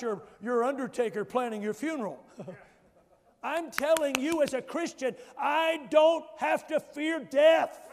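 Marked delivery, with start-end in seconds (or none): 3.4-7.8 loud, rising preacher's shout, slow and heavy; full force preaching. Acted. Preacher shouting to the congregation he fears no death. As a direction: proclaim it like a triumphant cry.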